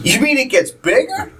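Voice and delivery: high, giggling innocent question; laughing kid voice. Retold or imitated. Boy asking his mom, high and laughing. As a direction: squeak it out through laughter.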